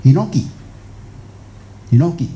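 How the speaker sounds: hinoki drawn out slowly, twice; Japanese word hinoki. Shown as a demonstration. Naming the Japanese tree word for listeners, slow demo. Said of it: "扁柏叫做块木".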